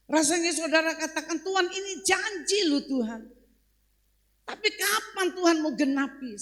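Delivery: loud, high challenge to God; impassioned preacher's pleading; full-force preaching. Acted. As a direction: voice the congregation's complaint forcefully. Voices congregation's complaint to God.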